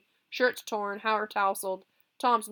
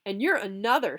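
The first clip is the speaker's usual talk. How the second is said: sneering; 3 semitones higher, 7 dB louder than usual. Acted. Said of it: "sneered Billy through clenched teeth".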